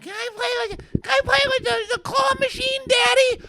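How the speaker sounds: put-on very high pleading kid shout; whiny kids. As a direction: beg like a little kid. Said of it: "The kids are like".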